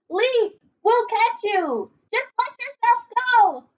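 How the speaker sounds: high, loud coaxing shouts; the crowd below calls to Kevin to leap; crowd urging. Acted. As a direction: yell up encouragement to jump. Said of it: "the crowd called".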